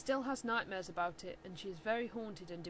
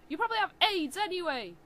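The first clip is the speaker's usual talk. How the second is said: angry shout; 9 semitones higher, 8 dB louder, faster than usual. Acted. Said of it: "I shouted".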